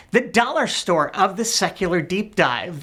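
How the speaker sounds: ordinary talk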